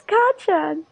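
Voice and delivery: high, imitated call; Deku. Voices Deku calling out.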